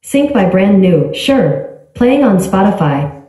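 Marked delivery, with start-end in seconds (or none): none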